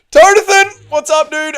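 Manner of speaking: high, loud hyped hello; excited shout greeting. Real, not acted. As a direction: yell the name to greet them.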